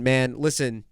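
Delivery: ordinary talk, nothing marked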